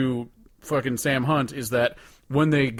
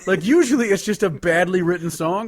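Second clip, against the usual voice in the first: excited rant; 7 semitones higher, 7 dB louder than usual. Real, not acted. Ranting to his co-host about the worst song, excited and loud. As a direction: rant about how bad it is.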